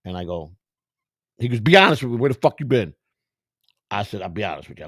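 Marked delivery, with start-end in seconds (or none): 1.6-2.9 loud, raised retold demand; demanding manager. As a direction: imitate a boss demanding the truth.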